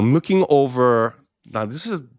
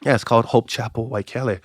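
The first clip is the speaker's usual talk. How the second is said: normal talking voice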